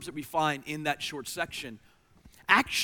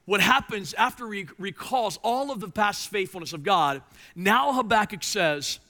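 fervent preaching; 6 semitones higher, 8 dB louder than usual. Acted. Preaching at full force.

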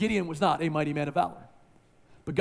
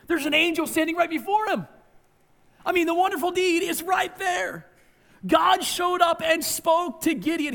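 high, loud, amazed outburst; can't believe he misses it; preacher marvelling loudly at Gideon's blindness; incredulous exclamation; 10 semitones higher, 4 dB louder than usual. Real, not acted.

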